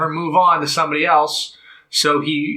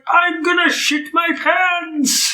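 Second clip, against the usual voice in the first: high, slow mock-possessed shout; possessed man announcing disaster, pitched way up; [name]; 14 semitones higher, slower than usual. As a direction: drag out a hammed-up possessed cry. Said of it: "being possessed".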